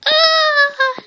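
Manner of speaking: shrill cartoon yelp of fright; frightened cat's high scream; high startled scream. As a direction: shriek as the big eyes appear. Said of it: "screamed Garfield".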